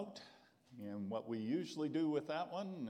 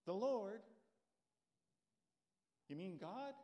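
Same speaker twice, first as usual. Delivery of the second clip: amazed Jack; 5 semitones higher than usual. Acted. Jack answers voice from above.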